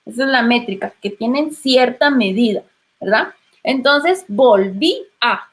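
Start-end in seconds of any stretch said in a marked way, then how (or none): 4.3-5.5 "volví a" slowly, the final i and a kept apart; "volví a" drawn out with a break between vowels; hiatus, vowels apart. Shown as a demonstration. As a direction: separate "volví" from "a" clearly. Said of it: "esa tilde va a permitir".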